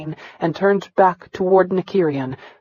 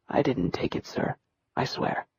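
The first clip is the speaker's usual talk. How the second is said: barely voiced whisper; whispered denial; 4 semitones lower, 12 dB quieter than usual. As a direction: breathe the denial out, afraid to speak. Beaten boy denies theft.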